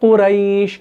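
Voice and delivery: drawn-out, gliding "ai" in कुरैश; smooth, lengthened कुरैश; soft leen. Shown as a demonstration. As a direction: hold the "ai" of कुरैश gently on a level tone. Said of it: "नरमी से अदा कर रहे हैं".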